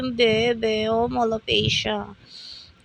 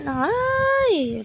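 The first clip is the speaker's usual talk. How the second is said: high sudden yelp; quick high surprised cry; startled squeal; 11 semitones higher than usual. Real, not acted. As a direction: jump up high as if caught off guard. Reacts to the high ride.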